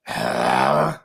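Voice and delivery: a sleepy groan on waking; just woken up, groan into the phone; groggy sigh. Retold or imitated. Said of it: "here's the reenactment".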